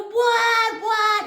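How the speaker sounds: high, loud re-enacted "what"; panicked calling. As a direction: call down the stairs in alarm.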